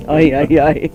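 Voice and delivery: ordinary delivery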